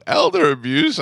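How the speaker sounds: high, disbelieving echo; mock outrage at the charge; incredulous retelling. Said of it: "I go".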